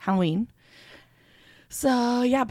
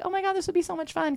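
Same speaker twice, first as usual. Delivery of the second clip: gushing excitement; 12 semitones higher, faster than usual. Retold or imitated. Excited about the cabin trip.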